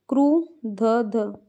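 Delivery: "क्रुद्ध" very slow, split as "kru-d-dha"; drawn-out sounding out. Shown as a demonstration. Teacher spelling it for students, "क्रुद्ध" slowly broken up. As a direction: sound out "क्रुद्ध" piece by piece, stretching each part.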